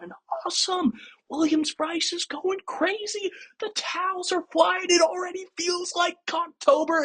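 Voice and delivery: very high falsetto gushing; giddy high-pitched mock celebration; mock fan excitement. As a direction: squeal like an overjoyed fan, hammed-up. Mimics giddy fans after touchdown.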